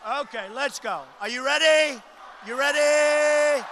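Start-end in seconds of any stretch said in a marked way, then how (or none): 2.5-3.6 slow, stretched call out to the crowd; hyping up the rally crowd; drawn-out crowd call. Real, not acted. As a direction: stretch "ready" like a ring announcer. Rally host calling to crowd.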